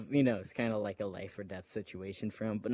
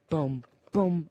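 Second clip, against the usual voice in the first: mock boom; 5 semitones higher, 4 dB louder than usual. Kid mimics hitting sounds.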